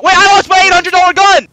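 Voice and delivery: high, loud outcry of loss; alarmed shout. Real, not acted. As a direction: yell it in dismay. Lost his gun in game.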